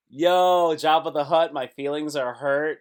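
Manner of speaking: shouting a goofy made-up rhyme; mock rhyming shout. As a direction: belt it like a silly diss rhyme. Mock rap reply.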